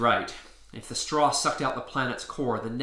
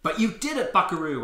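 a touch of cheering; 6 semitones higher, 6 dB louder than usual. Acted. Cheering his friend on.

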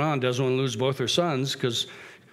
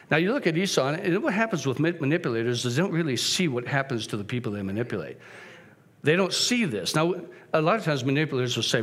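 ordinary talk

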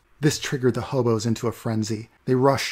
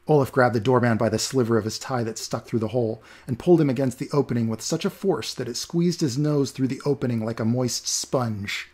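usual speaking voice

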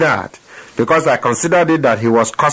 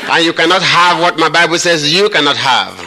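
preaching at full force; 5 semitones higher than usual. Acted. Preacher leading the congregation against the devil, full-force proclamation. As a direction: proclaim it like a battle cry to the devil.

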